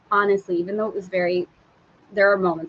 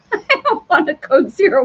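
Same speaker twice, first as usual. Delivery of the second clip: laughing, much higher and louder; laughing refusal; 9 semitones higher, 7 dB louder than usual. Retold or imitated. Telling husband she refuses the sail, laughing high. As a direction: laugh it out as a flat no. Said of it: "I told Dave last night".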